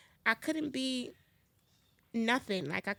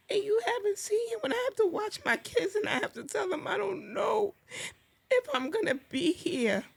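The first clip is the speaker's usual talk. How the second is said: real tears breaking into a high voice; fighting back sobs as she says it; 10 semitones higher than usual. Real, not acted. To podcast hosts about telling her kids, crying.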